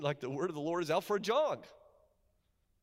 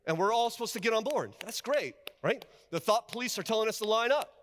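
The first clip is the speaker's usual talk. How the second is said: sarcastic, raised voice; 7 semitones higher, 4 dB louder than usual. Acted. Preacher mocks culture's demands.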